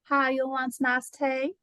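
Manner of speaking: slow, sweet Southern drawl offering tea; Southern hospitality voice, slowed down; a lightly put-on Southern greeting; southern accent. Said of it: "like, a southern".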